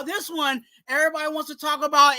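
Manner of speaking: ordinary talk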